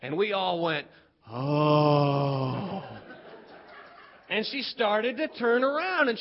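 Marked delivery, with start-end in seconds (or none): 1.3-2.8 low, drawn-out "oh"; group gasp of awe. Retold or imitated. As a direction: imitate kids letting out one long oh. Kids' group reaction, acted.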